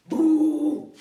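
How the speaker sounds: sudden loud, high "boo"; shouted boo. Acted. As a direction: shout to startle a sleeper. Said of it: "Gritó en la misma oreja". (grito fuerte de susto)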